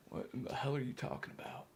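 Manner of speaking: a would-be Scottish brogue that slides into Australian; quick, hushed bad Scottish accent; attempted Scottish accent. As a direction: try a Scottish accent and land somewhere near Australia. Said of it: "People are saying you're doing an Au-, Australian instead of a Scottish".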